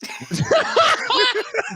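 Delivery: high burst of real laughter; cracking up hard; big laugh. Real, not acted. Friend cracks up at the nose grab story.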